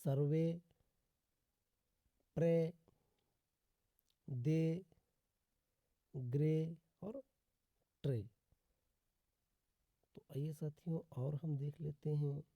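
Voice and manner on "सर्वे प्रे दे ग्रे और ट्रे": word-by-word demo, each ending in a held "ay"; teacher's spaced-out examples, ए sustained in प्रे and ग्रे; final "ay" sound. Shown as a demonstration. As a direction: say सर्वे, प्रे, दे, ग्रे with a long ए at the end. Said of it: "E Y का उच्चारण ए होता है".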